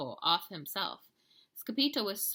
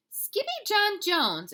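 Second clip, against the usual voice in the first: much higher, louder, slow mother's cry; exclaimed; 9 dB louder, slower than usual. A narrator's reading. Mama finding her son's mess, high slow exclaim. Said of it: "exclaimed Mama".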